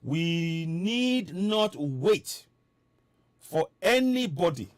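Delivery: "We need not wait" spaced out, very slow; slow it right down to drive the point home; deliberate slow repetition for emphasis; said again slowly. Shown as a demonstration. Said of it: "Let me say it again slowly".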